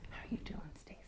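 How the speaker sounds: hushed, gentle hello in a whisper; quiet mock whisper to a friend. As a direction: whisper the polite greeting. Contrasting polite greeting with touch.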